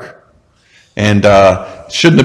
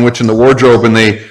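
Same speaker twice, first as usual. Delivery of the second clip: usual speaking voice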